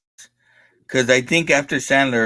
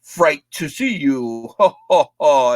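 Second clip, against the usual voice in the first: hammed-up Dracula host; slower than usual. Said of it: "he was Dracula".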